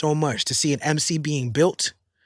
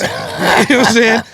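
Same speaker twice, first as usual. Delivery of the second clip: laughing; 7 semitones higher, 6 dB louder than usual. Real, not acted. Laughing at own joke.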